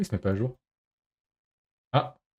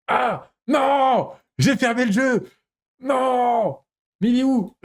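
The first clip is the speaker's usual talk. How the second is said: real panic, shrill-high wails; panicked; 10 semitones higher, much slower than usual. Real, not acted. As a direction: yelp in dismay at the closed game. Accidentally closed the game.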